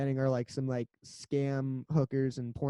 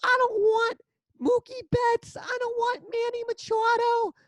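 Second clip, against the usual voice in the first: put-on high, slow whiny kid with thick accent; Yankee kid voice; 6 dB louder than usual. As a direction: squeal it like a bratty fan kid. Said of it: "this kid with a super thick".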